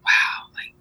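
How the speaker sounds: breathless hushed wow; awed whisper. Retold or imitated. Mother quoting people amazed by her kids, hushed whisper.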